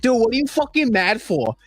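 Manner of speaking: high, strained mock yell of a furious fan; angry New York fan. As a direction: squeal it like a drunk fan raging at the refs. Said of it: "the other dude's like".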